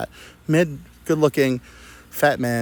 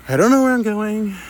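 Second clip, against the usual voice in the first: put-on confused drunk self; 8 semitones higher, 4 dB louder than usual. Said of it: "I go".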